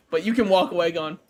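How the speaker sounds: ordinary delivery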